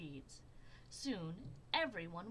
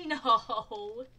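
loud emphatic no; punchline; 2 semitones higher, 15 dB louder than usual. A narrator's reading. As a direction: land the punchline hard. Punchline after laugh.

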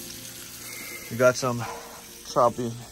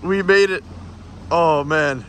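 excited shout; 7 semitones higher, slower than usual. Real, not acted. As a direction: cheer it like you made it. Arriving back at marina.

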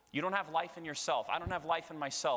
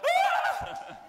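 a sudden very high, loud mock shriek of fright; startled scream; 8 dB louder than usual. Priest acting fright at Jesus coming through the door, mock shriek. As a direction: shriek as if someone just came through a locked door. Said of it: "That's what would be my reaction".

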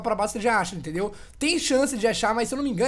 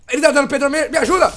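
loud call for help; 3 semitones higher, 9 dB louder than usual. Real, not acted. Being hurt, calls for help.

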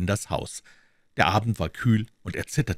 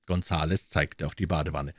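plain reading voice

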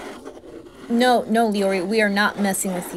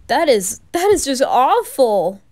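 horrified gasp; 5 semitones higher, 7 dB louder than usual. Acted. Horror at the killing.

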